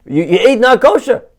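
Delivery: loud, high comic disbelief; outraged rabbi. Acted. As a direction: explode in mock horror at the confession. Rabbi shocked by the confession.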